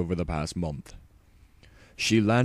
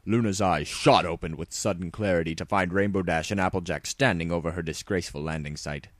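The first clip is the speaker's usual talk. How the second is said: ordinary delivery, nothing marked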